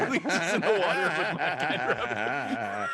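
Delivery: slow, loud, gloating; booming arrogant alien overlord. Acted. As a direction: boom it out like a cackling tyrant. Gloating about spell.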